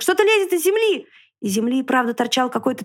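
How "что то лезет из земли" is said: высоко и громко, испуганно; тревожно крикни другу; взволнованный крик. A narrator's reading. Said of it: "закричал поросёнок". (взволнованно и громко)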